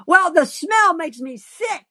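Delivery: high, slow wail of disgust; high-pitched, drawn-out complaint; crying out. Acted. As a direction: cry out in revulsion, drawing it out. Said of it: "cries Sonny".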